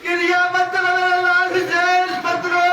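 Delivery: a lightly voiced father begging his son not to weep, in chanted lament; grieving father's plea. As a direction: plead gently but rising, as Ali to Husain. Ali consoling Husain, voiced.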